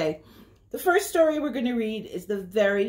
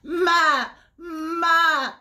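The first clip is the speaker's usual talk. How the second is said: high, loud, quavering mock bleat; goat bleat; 7 semitones higher, 3 dB louder than usual. Goat calling to the spider, high quavering bleat. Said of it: "said the goat".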